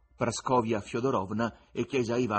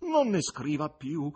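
slow, higher plea; drawn-out, earnest entreaty; imploring voice; 5 semitones higher, 4 dB louder than usual. A narrator's reading. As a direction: beg slowly, stretching the words. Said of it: "pregò l'uomo con voce implorante".

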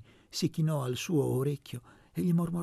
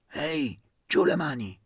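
sharp, louder rebuke; furious; 3 semitones higher, 6 dB louder than usual. Acted. Aunt turning on the boys, furious. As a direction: snap at the hands going where they shouldn't. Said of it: "voltandosi infuriata".

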